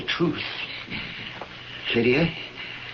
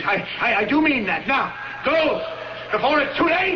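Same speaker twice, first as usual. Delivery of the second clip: 1 urgent pleading; 8 semitones higher than usual. Acted. Urging Lydia to hurry.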